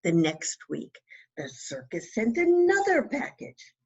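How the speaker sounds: ordinary delivery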